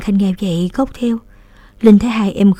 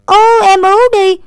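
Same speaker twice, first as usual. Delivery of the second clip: put-on much higher, loud little boy's whiny no; clear child voice; 12 semitones higher, 8 dB louder than usual. A narrator's reading. Little boy refusing the errand before running off, childish. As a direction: refuse in a bright small-child voice. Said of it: "giọng trẻ con ... trong vắt".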